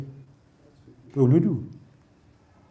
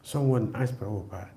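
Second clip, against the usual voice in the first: ordinary talk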